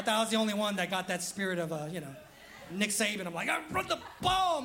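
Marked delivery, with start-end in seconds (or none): 3.5-4.6 high, strained holler at the coach; exasperated fan yelling. Acted. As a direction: yell it like an armchair coach. Acting out himself yelling at Saban.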